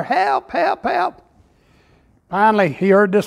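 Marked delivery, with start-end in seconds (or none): 0.0-1.2 a man dangling from a cliff, hollering for help. Retold or imitated. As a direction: yell up the cliff for help, hammed up. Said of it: "he was hollering".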